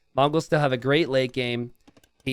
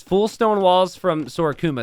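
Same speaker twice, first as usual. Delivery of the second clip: usual speaking voice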